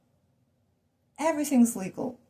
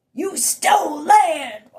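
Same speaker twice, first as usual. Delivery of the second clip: high, loud, drawn-out taunt; mock accusing yell; 7 semitones higher, 8 dB louder, much slower than usual. Accusers yelling at settlers about land, mock accusing yell. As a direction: stretch out a hammed-up accusation.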